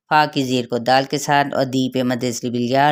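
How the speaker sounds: ordinary talk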